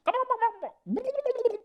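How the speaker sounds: very high, gargled sped-up gobble; drowning turkey gobble. Retold or imitated. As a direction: gobble like someone drowning, fast. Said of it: "sounds like somebody's drowning, but sped up".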